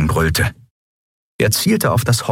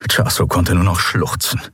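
ordinary delivery

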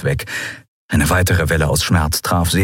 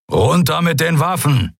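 firm order, a little higher; commanding; 8 semitones higher than usual. A narrator's reading. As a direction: command them to disarm. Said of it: "befahl Keru".

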